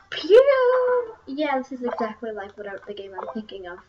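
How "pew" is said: high, loud "pew"; imitated zap noise. Real, not acted. Gamer echoing the game's action, high loud sound effect. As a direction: mimic a shot flying off, pitched high.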